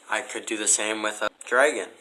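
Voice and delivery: ordinary talk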